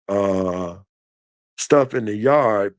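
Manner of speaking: ordinary talk, nothing marked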